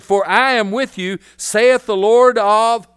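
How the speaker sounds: slow, loud, ringing declaration; proclaimed scripture. Acted. Preacher proclaiming God's words to the congregation, slow and loud. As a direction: proclaim it like the voice of the Lord.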